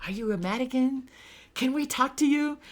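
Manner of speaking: high, eager questions from curious locals. Retold or imitated. Locals eagerly quizzing the American tourist, high and keen. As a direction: pitch it up like a keen stranger asking. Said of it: "we had people asking us".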